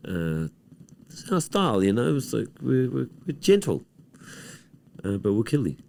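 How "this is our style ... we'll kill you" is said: lightly voiced Polynesian accent, higher pitch; a touch of a Polynesian bouncer's accent. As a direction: speak as a gentle Polynesian bouncer. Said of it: "Maori and Polynesian".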